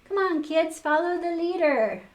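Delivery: high, loud leader's call; loud high cheering snowman; parade call. A narrator's reading. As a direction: lead the parade happily. Frosty leading parade.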